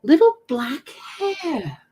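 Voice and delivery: grossed-out drawn-out voice; grossed-out drawl. Real, not acted. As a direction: stretch it out with disgust. Disgust at hairs in keyboard.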